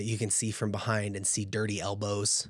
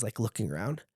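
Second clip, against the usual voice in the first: ordinary talk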